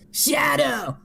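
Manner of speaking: very high shouted scream; hammed-up shrieking Sonic. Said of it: "Sonic screams in return".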